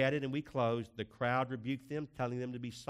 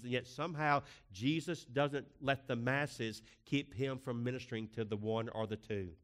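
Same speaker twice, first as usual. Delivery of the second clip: normal talking voice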